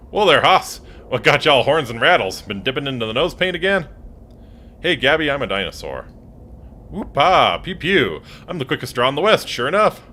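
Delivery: put-on a rowdy old prospector in a cowboy accent, hollering; cowboy accent, greeting the dinosaur with a holler. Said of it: "Gabby doesn't talk like that".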